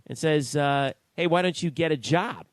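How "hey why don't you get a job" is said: mild mock yell, raised pitch and a bit louder; retold screaming. Radio host retells coach screaming at a heckling fan, mild mock yell. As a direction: imitate an angry shout without fully shouting. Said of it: "starts screaming at the fan".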